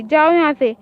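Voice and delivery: loud angry shout to get out. Acted. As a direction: throw him out with a yell. Angry at intruder in room. (गुस्से में ज़ोर से चिल्लाना)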